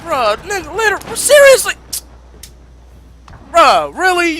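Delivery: outraged yell. Real, not acted. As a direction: scream in disbelief at the game. Outraged at spawn placement.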